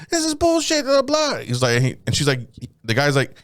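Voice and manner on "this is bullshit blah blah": high whiny girl imitation; high mock whine. Girl complaining to the officers, high mock whine.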